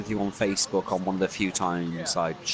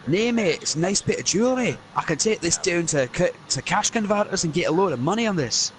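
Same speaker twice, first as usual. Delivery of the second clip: higher Scottish elf voice, cheeky and casual; a greedy elf haggling in Scots; 7 semitones higher than usual. Acted. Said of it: "the elf's like".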